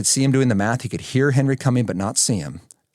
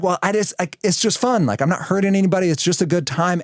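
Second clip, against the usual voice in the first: put-on carefree teens; 7 semitones higher than usual. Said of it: "And they're like".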